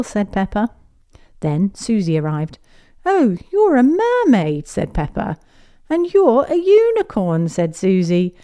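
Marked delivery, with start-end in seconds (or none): 3.0-4.7 high, slow, louder delight. Acted. Pepper to Susie, high delighted surprise. 5.9-7.5 high, louder delight. Acted. Susie to Pepper, high delighted surprise.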